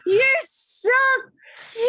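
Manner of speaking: high, squealing gush over something adorable; breathless high-pitched adoration; gushing delight. Acted. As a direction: coo it like you just saw a puppy. Boxy gushes at tiny creature.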